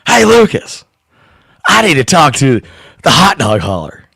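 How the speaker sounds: loud, high announcer voice; Harry Carey voice. Pretend. Prank call to the shop kid, loud high announcer voice. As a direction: bellow it like an old ballpark announcer. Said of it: "gonna call up and be like".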